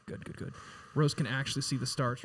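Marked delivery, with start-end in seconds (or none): 0.1-0.5 soft unvoiced whisper of approval; whispered praise. Real, not acted. Director whispering praise to the sound guy. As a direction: breathe the praise under the scene.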